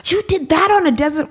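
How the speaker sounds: high, fast, astonished question; mock amazement at the girls' desert show; amazed disbelief. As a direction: toss it off as if you can't believe it. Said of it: "even those of us that live here were like".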